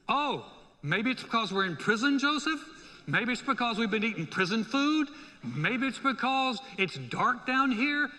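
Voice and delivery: loud, high mock guessing; sarcastic retort. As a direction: toss back obvious answers with rising exasperation. Prisoners' sarcastic retort to Joseph.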